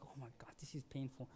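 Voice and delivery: hushed, fast, strained; a fast, hushed complaint of pain; pained gasp. Retold or imitated. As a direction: gasp it out under your breath while sucking on the breathing device. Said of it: "it's like".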